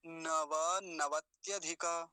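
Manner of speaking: slow, every vowel full; deliberate teaching demonstration; model pronunciation. Shown as a demonstration. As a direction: draw the compound out carefully. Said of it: "ऐसा बोलेंगे".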